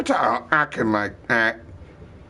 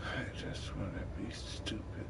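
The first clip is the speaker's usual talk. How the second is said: hushed, much softer whisper; 17 dB quieter than usual. Real, not acted. Confessing to himself, whispered. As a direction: whisper it like a secret.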